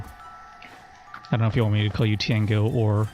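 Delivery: ordinary talk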